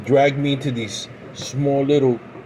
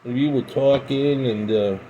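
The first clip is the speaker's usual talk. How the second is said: normal talking voice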